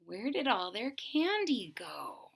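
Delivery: plain reading voice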